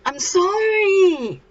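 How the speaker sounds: high, loud, drawn-out cry; wailed apology. Acted. Boy calls after the girl he hurt, hammed-up wail.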